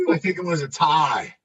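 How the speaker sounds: ordinary talk, nothing marked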